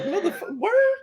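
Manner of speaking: very high, incredulous squeak; mock disbelief. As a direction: play the stunned father way up high. Acting out Scott's shocked reply.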